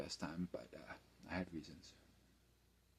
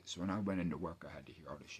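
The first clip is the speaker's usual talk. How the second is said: ordinary talk, nothing marked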